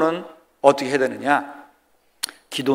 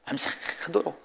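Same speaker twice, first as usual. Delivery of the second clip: whispered echo; 15 dB quieter, slower than usual. Acted.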